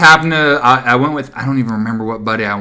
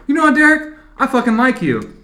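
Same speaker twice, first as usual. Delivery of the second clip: imitating young AJ; 11 semitones higher than usual. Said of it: "He goes".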